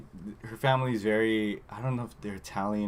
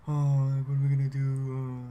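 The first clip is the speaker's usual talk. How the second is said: mock sad guy; 4 semitones higher, 3 dB louder than usual. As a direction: imitate a guy laying on the self-pity. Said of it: "plays a sad guy thing".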